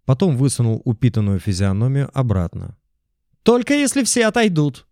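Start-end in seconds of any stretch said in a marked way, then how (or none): 3.4-4.9 high squealing shout; loud shout. A narrator's reading. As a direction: yell it from the window. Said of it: "громко выкрикнул он".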